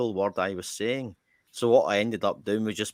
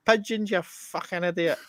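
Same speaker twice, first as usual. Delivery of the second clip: retold shouting; 9 semitones higher than usual. Friend heckling from outside the shop, high mock shouting. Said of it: "He's shouting".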